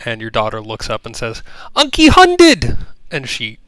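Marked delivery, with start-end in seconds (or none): 1.8-2.8 put-on very high, loud, slow child's shout of joy; little girl's cry. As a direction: squeal the name like a thrilled toddler. Little girl calls her uncle.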